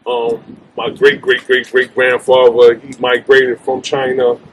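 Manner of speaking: ordinary delivery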